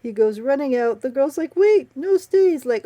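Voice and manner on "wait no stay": high, loud imitation of calling after someone; hammed-up plea to stop; mock pleading call. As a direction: urgently call him back, imitating. Girl calls after fleeing man.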